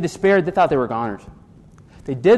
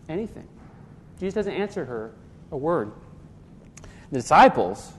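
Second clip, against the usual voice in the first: ordinary talk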